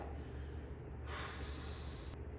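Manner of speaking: a long breathy exhale, slumped against the wall; tired drunk sigh; long whispered sigh. Retold or imitated. Slumped in stall.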